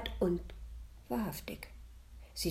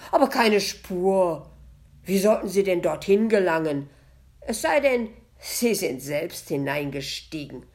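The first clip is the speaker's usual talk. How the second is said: threatening; 4 semitones higher, 7 dB louder than usual. A narrator's reading. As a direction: push back at him with a threat. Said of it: "fügte sie drohend hinzu".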